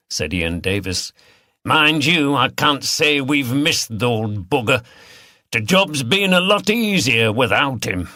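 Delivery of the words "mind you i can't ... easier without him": gruff regular. Acted. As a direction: voice a blunt local glad the old bugger's gone. Davis gripes about dead boss.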